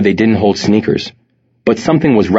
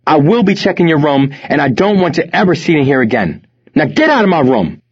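high-pitched, forceful telling-off; angry scolding; 8 semitones higher than usual. A narrator's reading. Mother confronting her drug-dealing son, angry scolding. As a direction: lay down the law, outraged.

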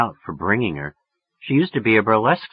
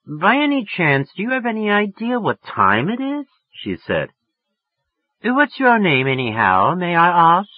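much higher, put-on English accent; 9 semitones higher than usual. A narrator's reading. Woman on the phone putting on an English accent. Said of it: "getting an English accent all of a sudden".

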